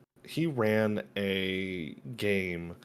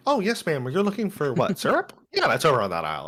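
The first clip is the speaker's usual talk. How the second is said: high, loud customer-service voice; bright shop assistant pitch; chipper clerk voice; 7 semitones higher, 6 dB louder than usual. Retold or imitated. Switches to cheerful store clerk.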